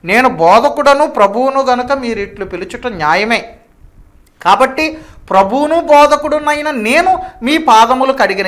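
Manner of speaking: loud, high preacher's quoting voice; scripture declamation. Acted. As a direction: proclaim the verse at full force. (బిగ్గరగా వాక్య ప్రకటన)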